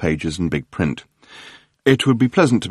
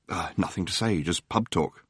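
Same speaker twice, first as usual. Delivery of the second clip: weary Arthur; 2 semitones lower, 10 dB quieter than usual. Acted. Arthur brushing off the journalist, much softer. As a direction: mumble it off, wanting no part of it.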